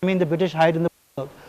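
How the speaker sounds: ordinary talk, nothing marked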